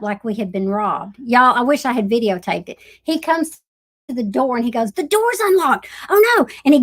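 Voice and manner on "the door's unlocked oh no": higher, rising panic; mock alarm. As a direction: act out the shock at the open door. Husband finds staged robbery.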